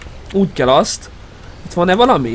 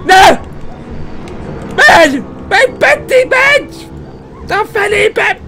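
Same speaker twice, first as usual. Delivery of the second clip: panicked cries; 13 semitones higher, much slower than usual. Real, not acted. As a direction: shriek to get away. Fleeing enemy in panic.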